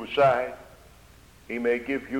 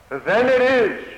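rising preacher's cry; 10 semitones higher, 7 dB louder, slower than usual. Real, not acted. Preacher building to a climax.

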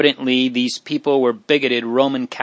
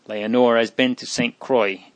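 ordinary delivery

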